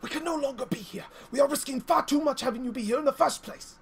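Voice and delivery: urgent angry demand; ranting. Acted. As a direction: push hard to leave the port. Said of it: "she's just ranting on".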